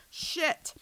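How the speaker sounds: loud, high burst of alarm; sudden startled exclamation; alarmed curse. A narrator's reading. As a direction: yelp it out as danger appears. Sudden danger on the road.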